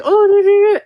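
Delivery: very high, loud mock cooing; exaggerated baby-talk dismay; fussing grandparent. Grandparent to toddler with a tiny bruise, high fussing coo.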